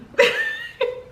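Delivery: high laughing squeal. Real, not acted. Laughs at her own line.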